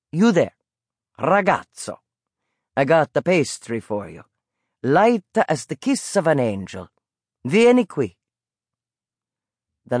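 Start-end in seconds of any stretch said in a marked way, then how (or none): 0.1-8.2 lightly voiced Italian peddler calling out; old Italian vendor. A narrator's reading. Old vendor calling the boy to his pastry cart. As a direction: coax the boy over like a kindly street vendor.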